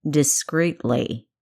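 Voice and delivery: Discreetly slow and weighty; emphasized. A narrator's reading. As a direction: lean on every syllable of Discreetly so it sinks in. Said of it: "He emphasized the next word".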